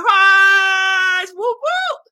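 high, loud whooping call; excited shout. Acted. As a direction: shout it like an air horn. Reading comment, mimicking air horn.

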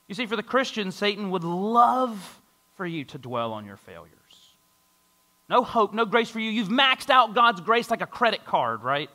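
ordinary talk, nothing marked

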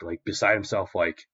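ordinary delivery, nothing marked

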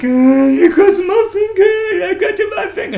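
hammed-up bawling through a laugh; mock crying. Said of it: "the guy was crying".

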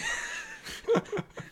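sudden high laugh; burst of laughter. Real, not acted. Storyteller cracking up at his own story, high burst of laughter.

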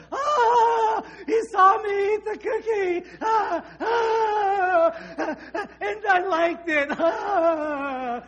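put-on high, loud wailing toddler confession; sobbing it out like a little girl caught; toddler falling apart. Little girl caught with cookie crumbs, wailing high and loud. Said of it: "she'd go all the pieces and go".

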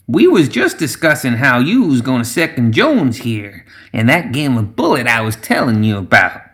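steer them back with exaggerated rises and falls; swamp witch Zoraida. Voices Zoraida steering the talk back.

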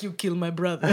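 put-on menacing sea lion in a jokey Russian accent. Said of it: "I don't know why I made him Russian".

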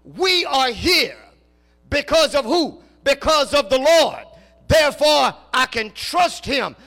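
loud, rising preacher's cadence, slow and weighty; preaching at full force. Acted. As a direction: preach it out to the congregation. Preacher building to a climax.

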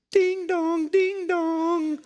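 high singsong ding-dong; doorbell imitation. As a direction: chime it out like a doorbell. Imitates doorbell as company arrives.

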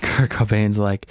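normal talking voice

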